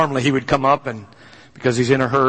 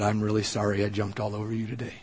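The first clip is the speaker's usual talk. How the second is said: much softer, humble apology; tearful apology; 6 semitones lower, 11 dB quieter than usual. Retold or imitated. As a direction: say sorry gently, near tears. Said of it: "with some tears".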